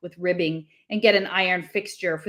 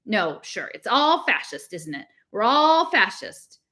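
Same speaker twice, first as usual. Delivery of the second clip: sarcastic; 2 semitones higher than usual. Acted. Boy gets sarcastic with his friend mid-argument. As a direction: agree sarcastically, dragging out the last line. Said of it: "And I got sarcastic".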